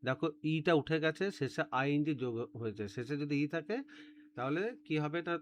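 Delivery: ordinary talk, nothing marked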